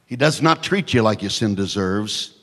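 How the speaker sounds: ordinary talk, nothing marked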